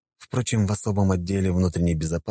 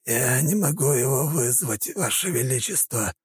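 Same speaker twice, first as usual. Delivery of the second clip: strained, wheezing plea; hoarse wheeze; 9 semitones higher than usual. A narrator's reading. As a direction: rasp it out weakly. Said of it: "просипел князь".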